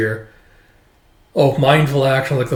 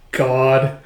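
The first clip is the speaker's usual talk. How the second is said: normal talking voice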